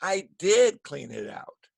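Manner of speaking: "did" punched and held long; slow, weighty "did"; stress on DID. Shown as a demonstration. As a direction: insist by stretching "did". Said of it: "It's for emphasis".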